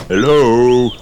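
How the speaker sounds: loud hello at a door; calling hello. A narrator's reading. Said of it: "called Daddy Pig".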